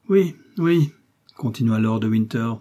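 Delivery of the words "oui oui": high, loud, taunting yes; mock agreement. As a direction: humour the prisoner mockingly. Winter taunts his prisoner.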